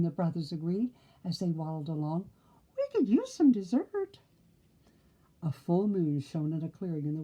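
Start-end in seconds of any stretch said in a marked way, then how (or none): 2.8-4.2 high piggy voice. Acted. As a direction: squeal it like a happy pig. Pig character voiced high.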